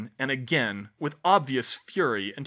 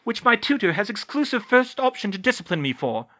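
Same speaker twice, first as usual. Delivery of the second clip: high, loud hurried retort; hasty high-voiced interruption; said quickly; 9 semitones higher, 6 dB louder than usual. A narrator's reading. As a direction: cut in quickly, pitched up. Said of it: "she said quickly".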